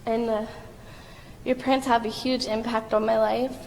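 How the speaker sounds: ordinary talk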